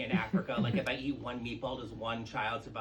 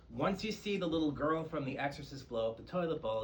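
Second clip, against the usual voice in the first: ordinary delivery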